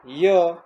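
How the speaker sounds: ય as a high, loud "yuh"; letter-sound demo. Shown as a demonstration. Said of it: "યુનો ઉચ્ચાર થાય". (ય નો ઊંચો, મોટો ઉચ્ચાર)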